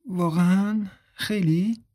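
innocent. Acted. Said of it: "اپریل معصومانه گفت".

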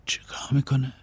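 whispered, barely voiced; soft secret whisper; hushed question. A narrator's reading. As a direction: ask it under your breath so nobody hears.

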